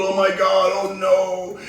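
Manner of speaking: climbing high into a mock sob; mocking the caller, ending in a pretend cry; mock hysterical doomsayer. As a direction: spiral into fake wailing panic. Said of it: "another one of these people".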